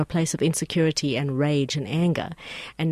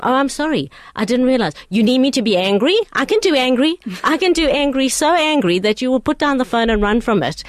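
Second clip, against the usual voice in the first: loud, high, sarcastic threat to get angry; hammed-up warning that she can do angry; mock angry threat; 6 semitones higher, 6 dB louder than usual. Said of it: "I said to him".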